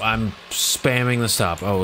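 ordinary talk

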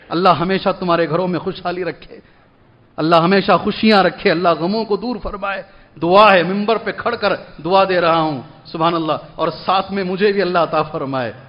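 gentle blessing. Real, not acted. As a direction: offer a quiet blessing from the pulpit. Blesses congregation from pulpit.

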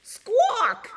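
very high, loud squawk; squawking hen. A narrator's reading. Hen ordering the horse out, shrill loud squawk. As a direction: screech it like an outraged hen. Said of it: "squawked hen".

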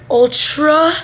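normal talking voice

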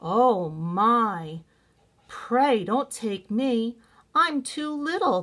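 high, pleading little goat; small goat voice. A narrator's reading. Littlest goat begging the troll, small high pleading. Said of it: "with such a small voice".

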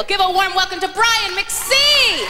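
big drawn-out announcer call; announcer's introduction. Real, not acted. Host introduces performer to the crowd, drawn-out announcer call. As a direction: build to a rousing, stretched-out name.

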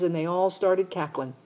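plain reading voice

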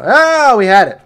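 high, loud groan at a near miss; frustrated outcry. Real, not acted. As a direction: cry out at just missing it.

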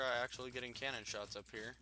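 usual speaking voice

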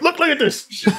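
high, whiny look at this; mock tattling voice. Friend joining the bit about showing off an injury, high mock whine. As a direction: point it out in a shrill mock whine. Mocking a scolding older sibling.

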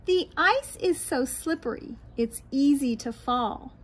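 usual speaking voice

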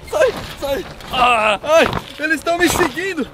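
ordinary talk, nothing marked

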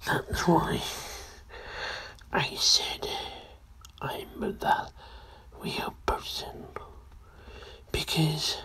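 hushed, eerie whispering; creepy whisper. Acted. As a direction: whisper like a hooded stranger.

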